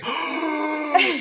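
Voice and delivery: sharp, high gasp after running out of air; gasp for breath. Shown as a demonstration. Demo of forgetting to breathe, sudden high gasp. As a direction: suck in a quick breath mid-sentence. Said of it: "I forget to breathe".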